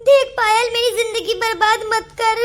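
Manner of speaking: sobbing, shocked plea; tearful begging. Acted. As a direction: implore with a crying voice. Begging not to ruin life.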